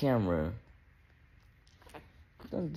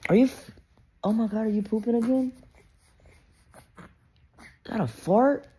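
surprised disbelief; 10 semitones higher than usual. Real, not acted. Baby pooping again.

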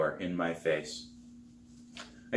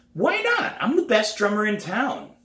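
much higher, louder indignant cry; indignant protest; 14 semitones higher, 5 dB louder than usual. Acted. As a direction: protest in disbelief. Girl refused, protests.

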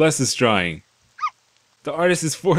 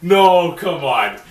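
protesting outcry; 3 semitones higher, much slower than usual. Real, not acted. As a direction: cry out against it. Protests abrupt ending.

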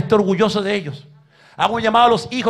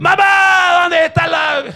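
put-on high, loud childish yell; mock shout for mom; 11 semitones higher, 9 dB louder, much slower than usual. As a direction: bellow for mom like a spoiled kid. Son yelling for mother.

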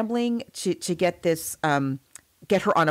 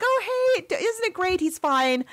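bright, much higher chirpy "everything's fine"; cheerful high wife voice; 11 semitones higher than usual. Retold or imitated. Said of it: "She's like".

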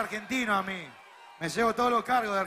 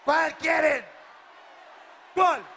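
higher, louder "¿Cuál quieren?" yelled out; shouted to the crowd; 5 semitones higher, 3 dB louder, much slower than usual. Real, not acted. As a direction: push "¿Cuál quieren?" up and out to the back rows. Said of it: "asks crowd twice".

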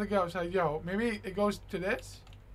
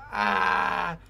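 ordinary talk

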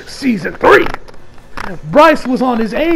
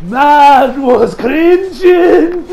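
laughing; 7 semitones higher, 8 dB louder, much slower than usual. Real, not acted.

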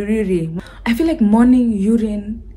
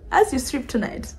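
normal talking voice

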